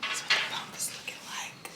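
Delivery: whisper. Real, not acted. Showing bump to camera.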